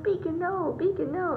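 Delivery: retold upset "no" to a dog; mock upset. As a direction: act out flustered scolding of a dog. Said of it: "all upset, 'cause they were like".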